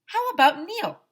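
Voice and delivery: high, loud pestering question; pestering kid. Acted. AJ pesters Woodsy about Neil, high loud ask. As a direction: push the bear with a high eager ask.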